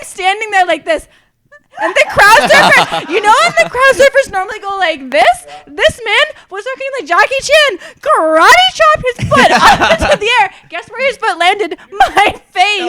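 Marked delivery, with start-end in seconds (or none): 1.8-12.9 excited, laughing storytelling. Real, not acted. Retelling getting kicked at concert.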